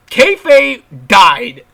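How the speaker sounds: kayfabe died, dragged out and loud; loud, drawn-out lament; loud slow declaration. Real, not acted. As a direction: proclaim it slowly like a death notice. Ranting about kayfabe dying.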